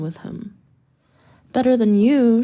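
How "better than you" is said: loud defiant shout at the crowd. A narrator's reading. Little brother shouting back at the taunting crowd, loud and defiant. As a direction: yell it back at them, standing firm. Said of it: "shouts my brother".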